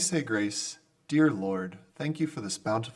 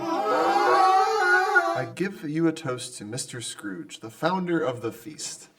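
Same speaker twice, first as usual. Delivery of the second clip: formal toast; 3 semitones higher, 3 dB louder than usual. Acted. Bob raises a toast.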